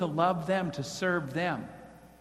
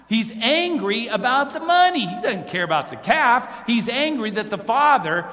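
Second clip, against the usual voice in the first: emphatic preaching; 6 semitones higher, 7 dB louder than usual. Real, not acted. Preacher stresses brother's anger.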